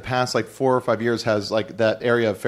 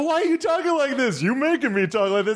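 high, flustered protest; hammed-up denial to the puppet; pitched-up panicked comic bit; mock horror. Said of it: "And then I go".

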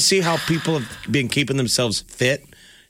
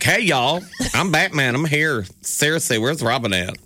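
higher-pitched, folksy superhero parody; Batman; 4 semitones higher than usual. Acted. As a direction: play a casual y'all superhero, pitched up. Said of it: "how would you want it to be".

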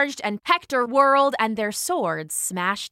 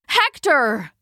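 anguished call, mildly raised; 3 semitones higher than usual. A narrator's reading. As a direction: call the name across a battlefield. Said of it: "Prince Paris called".

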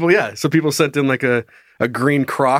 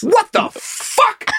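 very slow, high parrot squawk; drawn-out parrot mimicry; swearing parrot; much slower than usual. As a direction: stretch it out in a bird screech. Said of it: "They're just mimicking us".

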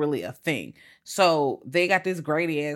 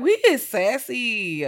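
higher, slow, amused; mock sassy remark; 6 semitones higher, 4 dB louder, slower than usual. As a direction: savor the sass slowly. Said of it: "I'm like".

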